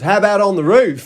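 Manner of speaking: put-on high, loud Aussie-accented question; Australian accent. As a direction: do the line as a jokey Australian. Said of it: "in an Australian accent for some reason".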